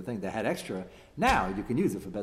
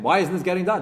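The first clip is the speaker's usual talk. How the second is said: impatient demand; 6 semitones higher, 7 dB louder than usual. Retold or imitated. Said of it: "Would you call in your Rebbe and ask him".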